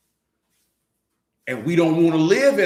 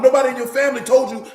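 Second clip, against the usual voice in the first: ordinary delivery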